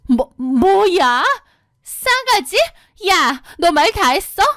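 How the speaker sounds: slow stammer then a high spike on 싸가지; outraged disbelief. Retold or imitated. Sister-in-law stunned at being called rude. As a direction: choke on the insult.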